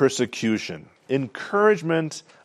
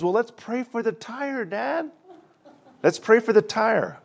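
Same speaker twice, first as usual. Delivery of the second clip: put-on young son's voice; 9 semitones higher than usual. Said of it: "And the son says".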